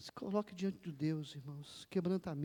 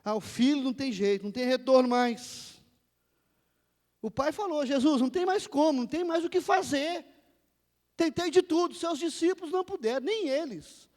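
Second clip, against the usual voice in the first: loud, high desperate father's plea; preacher's fervent re-enactment; impassioned preaching; 8 semitones higher, 11 dB louder than usual. Acted. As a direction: cry out as a father at his wits' end.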